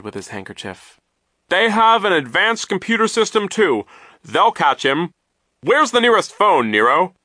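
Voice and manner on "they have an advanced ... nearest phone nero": high, loud, agitated voice; raised, swooping, excited delivery; flustered urgency. A narrator's reading. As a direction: call out the plan in alarm. Poe speaks in flustered alarm.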